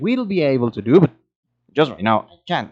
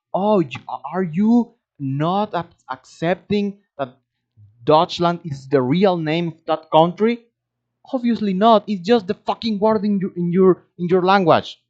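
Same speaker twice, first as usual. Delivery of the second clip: high-pitched, exasperated challenge; worked-up, indignant retort; annoyed rant; 7 semitones higher than usual. Retold or imitated. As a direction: retell an irritating argument, pitch climbing in disbelief. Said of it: "that was so annoying".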